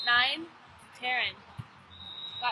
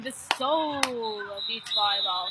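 slow, drawn-out announcer voice; imitating Ryan; slower than usual. Teen imitating the host Ryan's catchphrase, slow and drawn out. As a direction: stretch soul out like the court host. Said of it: "Loves saying".

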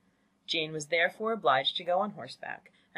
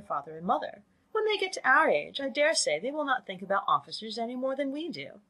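animated mother, higher and lilting; chatty, indulgent mother; 4 semitones higher than usual. Acted. Mother reminiscing to her husband about officers, chatty and indulgent. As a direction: gush along as a fond, silly mother.

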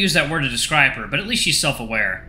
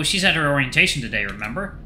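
plain reading voice

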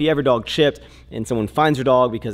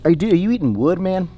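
surprised scolding; 7 semitones higher, 5 dB louder than usual. Real, not acted. Scolding dog chewing wood.

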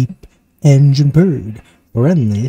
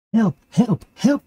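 much higher calls for help; cry for help; 10 semitones higher, slower than usual. Acted. Little Blue stuck in the mud, high cries for help. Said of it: "cried Little Blue Truck".

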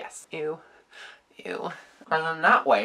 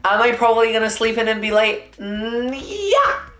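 excited; 6 semitones higher than usual. Real, not acted. Laughing self-mocking questions.

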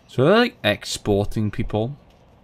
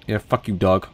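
usual speaking voice

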